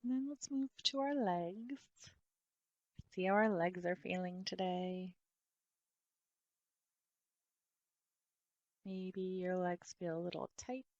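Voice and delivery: soft body-scan voice with long pauses; guided meditation. Real, not acted. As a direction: gently guide attention through the body, softly and unhurried.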